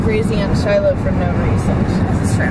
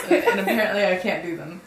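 ordinary talk, nothing marked

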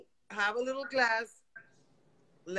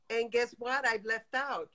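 ordinary talk, nothing marked

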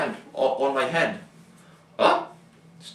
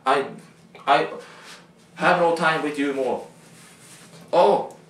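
ordinary delivery

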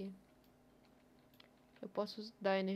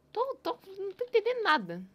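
loud, high exasperated cry; frustrated outburst; 11 semitones higher, 10 dB louder than usual. Real, not acted. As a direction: blurt it out in confusion. Confused by the game, exclaiming. (desabafo alto e frustrado)

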